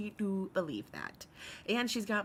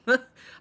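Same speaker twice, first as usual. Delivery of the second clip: short loud laugh bursting out; bright burst of laughter; 7 semitones higher, 12 dB louder than usual. Real, not acted. Streamer laughing loudly at chat's offer to rally. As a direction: laugh out suddenly, loud and high. Reacting to chat support.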